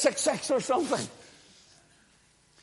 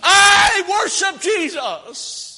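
high, loud, slow repeated Jesus, rising in rapture; ecstatic shouting; 9 semitones higher, 9 dB louder, slower than usual. Real, not acted. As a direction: cry out the name again and again in real joy. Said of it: "See, I get excited about those sort of things".

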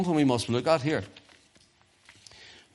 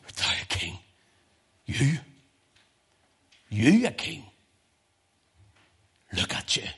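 hushed, scornful disbelief with long pauses; disdainful Pilate. Acted. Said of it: "He looks at him with disdain".

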